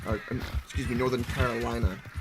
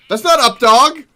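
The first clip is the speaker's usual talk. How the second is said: loud, higher burst of frustration at the game; frustrated shout; 9 semitones higher, 13 dB louder than usual. Real, not acted. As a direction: yell it at the screen, fed up. Frustrated at horse not mounting.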